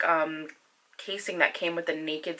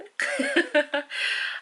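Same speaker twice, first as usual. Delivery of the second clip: breathy laugh; 10 semitones higher than usual. Real, not acted. Laugh after subscribe plea.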